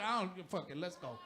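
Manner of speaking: low, soft, shrugging; mumbled don't-care to wife; muttered dismissal. Retold or imitated. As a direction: let it drop, low and quiet. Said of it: "I said".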